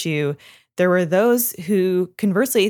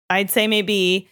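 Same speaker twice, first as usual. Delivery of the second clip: ordinary talk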